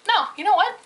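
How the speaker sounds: high and loud, rising no; defiant outburst. Retold or imitated. To her boss, refusing to reply, loud and defiant. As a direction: cut him off with defiance.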